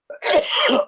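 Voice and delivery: harsh throat-clearing cough; coughing fit. Real, not acted. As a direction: cough hard mid-sentence. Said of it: "take a moment".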